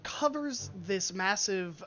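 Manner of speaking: slow, hushed scene setting; game master narration. Acted. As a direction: paint the dragon's arrival slowly. GM describes dragon emerging.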